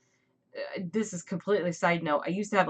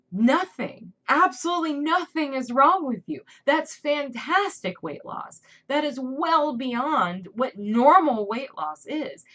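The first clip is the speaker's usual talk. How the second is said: emphatic reassurance; 7 semitones higher, 5 dB louder than usual. Real, not acted. Reassuring people after surgery.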